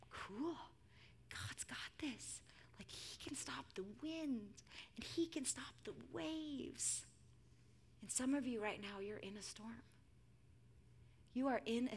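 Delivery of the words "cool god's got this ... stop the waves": put-on slow, breathed whisper of a child; whispery, drawn-out amazement; childlike wonder. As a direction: imitate an awed little daughter, hushed. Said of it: "in her childlike faith".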